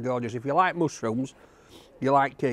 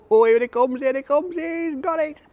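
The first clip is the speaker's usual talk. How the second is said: rising, louder and higher as the bird lands; real excited commentary, voice climbing high; 12 semitones higher, 4 dB louder than usual. Real, not acted. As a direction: excitedly call out the gull coming in. Gull swooping in on food.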